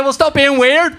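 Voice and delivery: loud, high-pitched mock telling-off; exasperated dad. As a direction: shout back over the noise at the kid. Acted dad snaps back at son.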